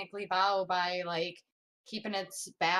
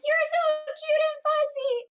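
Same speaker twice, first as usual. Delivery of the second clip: very high, loud squeal; 6 dB louder than usual. Acted. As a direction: squeal like a gushing fangirl. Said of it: "just squeals and hugs".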